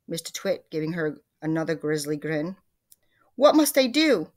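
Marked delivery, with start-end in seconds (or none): 3.4-4.3 high, loud pleading question; desperate cry. A narrator's reading. Missus Twit clutching her cheeks before her husband, crying out. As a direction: cry out for help in storybook panic. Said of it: "cried Missus Twit".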